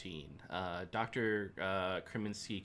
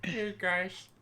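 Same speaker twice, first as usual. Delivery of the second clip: high puppet frog voice; high, bright-eyed frog puppet greeting; Kermit impression; 10 semitones higher, slower than usual. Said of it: "I'm Kermit the Frog".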